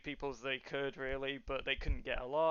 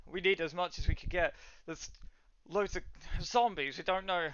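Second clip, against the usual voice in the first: terrified guard; 3 semitones higher than usual. Acted. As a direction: plead urgently for reinforcements, pitch raised. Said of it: "terrified right now".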